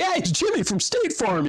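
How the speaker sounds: mock the cheery insurance agent; State Farm ad voice. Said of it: "I was like".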